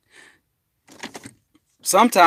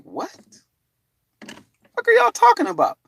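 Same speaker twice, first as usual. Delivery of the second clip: high, fast, confused; baffled question; 3 dB louder than usual. Retold or imitated. Baffled teller repeating his own confused question. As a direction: sputter out the confusion.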